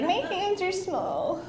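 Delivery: slow, high, soft whine; breathy-soft mocking whimper of a pop singer. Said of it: "She's like".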